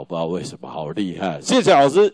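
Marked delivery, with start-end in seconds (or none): 1.4-2.1 much higher and louder bright thanks, mock-girlish; student who got her pass, gleeful high thanks; suddenly cheerful. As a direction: flip from tears to a chirpy thank-you. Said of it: "她就突然从哭变成笑".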